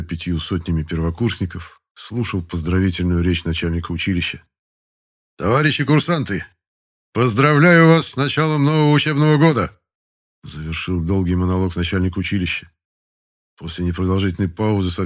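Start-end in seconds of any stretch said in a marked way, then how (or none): 5.4-9.8 loud, high speech to the ranks; formal address. Acted. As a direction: proclaim it to the whole parade. Генерал поздравляет строй. (торжественное громкое обращение)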